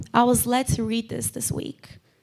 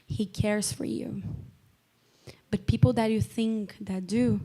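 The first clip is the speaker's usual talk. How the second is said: tender reassurance; 6 dB quieter than usual. Real, not acted. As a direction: let the preaching settle into gentle, quiet assurance. Closing assurance of God's love.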